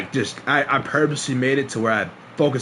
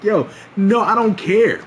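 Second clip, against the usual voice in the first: raised, exasperated insistence; acted irked; 7 semitones higher, 4 dB louder, slower than usual. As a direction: push back as if thoroughly annoyed. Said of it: "I'm irked as fuck".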